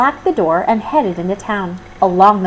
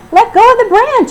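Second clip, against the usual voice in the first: high, loud call upward; 7 dB louder than usual. A narrator's reading. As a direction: shout up to her in the tree. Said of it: "she called out".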